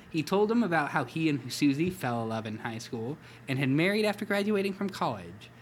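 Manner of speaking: ordinary talk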